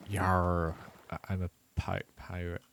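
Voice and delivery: lightly voiced tired pirate; half-hearted pirate growl. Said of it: "decided to become a pirate".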